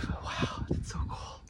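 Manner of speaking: shaky hushed whisper. Real, not acted. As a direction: whisper as if afraid to move. Scared at the top, whispering.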